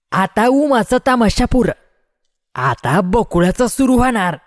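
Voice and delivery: high voice called over a crowd; oilman's declaration. A narrator's reading. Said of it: "तेली म्हणाला".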